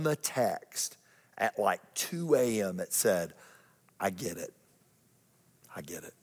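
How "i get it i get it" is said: quiet awe. Retold or imitated. Friend's text quoted quietly.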